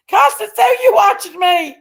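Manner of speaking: high, loud playful call to a viewer; excited call-out. Real, not acted. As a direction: sing out her name like spotting a friend. Calling out to viewer in chat.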